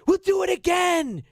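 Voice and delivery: booming, theatrical high line reading; hammy stage actor. Pretend. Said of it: "Say it with that acting voice".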